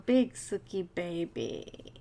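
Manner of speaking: slow, low cooing at the cat; slow pet-talk coo. Real, not acted. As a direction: coo it to a pet you adore.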